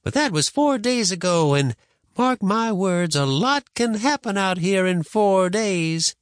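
high-pitched grandma, emphatic and drawn out; warning grandma. Acted. Grandma warning boy about dangers out here, emphatic. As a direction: warn the child with weight on each point.

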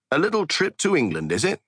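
high, lilting Cockney; Cockney accent. A narrator's reading. Podcast host Kuntz reacting to the England trip, mock Cockney. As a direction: put on a perky Cockney. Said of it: "in a Cockney accent".

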